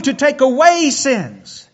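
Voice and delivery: normal talking voice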